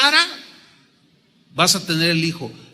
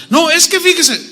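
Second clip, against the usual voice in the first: much higher, imitated wife's voice; interrupting wife; 8 semitones higher than usual. Wife butts in during pastoral counseling, imitated high-pitched protest. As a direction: cut in as an insistent wife. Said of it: "la esposa".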